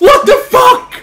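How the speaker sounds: furious high shout. Real, not acted. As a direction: yell in disbelief. Lost the game.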